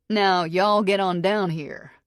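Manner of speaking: put-on slow, lightly drawled Texas accent. A narrator's reading. Jarrett teasing Megan on the phone in a mild Texas accent. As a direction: lay on a mild Texas twang, slowly. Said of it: "The accent makes me giggle".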